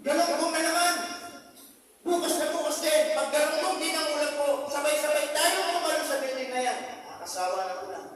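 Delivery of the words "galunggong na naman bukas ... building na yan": loud, high exasperated rant; fed-up outburst. Acted. Worker ranting to coworkers about his lunch, exasperated shout. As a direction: rail against the same fish again, louder.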